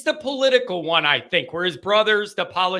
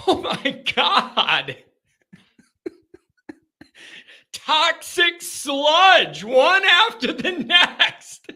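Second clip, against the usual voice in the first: laughing; 8 semitones higher, much slower than usual. Real, not acted. Host cracking up at chat.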